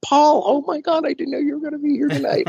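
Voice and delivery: high-pitched rush of delight, fast; excited gush. Retold or imitated. Tom greets Paul backstage, fast high excited gush. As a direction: gush happily at an old friend, fast and high.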